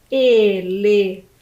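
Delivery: ELEFANTE slowly split into E, LE, FAN, TE; syllable by syllable. Shown as a demonstration. Teacher reveals the animal to pupils, syllable by syllable. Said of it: "quatro sílabas".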